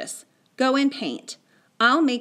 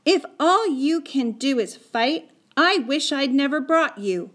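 put-on raised, cross boy's voice; shouted reproach; 6 semitones higher, 4 dB louder than usual. A narrator's reading. Boy regretting bringing Patrick along, cross raised voice. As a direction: scold your friend, higher than usual. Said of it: "he shouted at Patrick".

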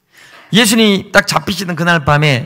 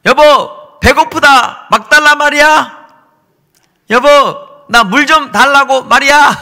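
high, loud playful calls to his wife; husband calling to his wife for food, high and loud; husband begging wife; 10 semitones higher, 7 dB louder, slower than usual. Retold or imitated. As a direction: whine for food like a hungry husband. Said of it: "나 우리 아내한테 자꾸 해요".

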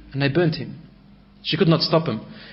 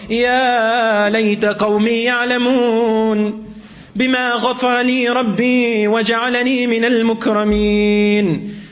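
slow drawn-out chanted verse; Quran recitation; 6 semitones higher, slower than usual. Acted. Preacher recites the verse to the congregation, slow and melodic. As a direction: stretch each word in melodic recitation.

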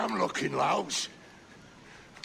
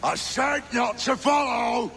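angry shout; 6 semitones higher than usual. Acted. Crazed pirate shouts at his shipmate not to follow. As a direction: yell it back over your shoulder.

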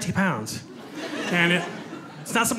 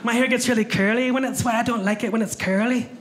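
higher Scottish lilt, light and chatty; imitating the Scottish friend; Scottish accent; 6 semitones higher than usual. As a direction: friend just off the plane from Scotland, Scottish accent. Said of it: "the first thing she said was".

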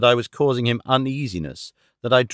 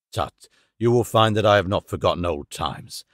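ordinary delivery

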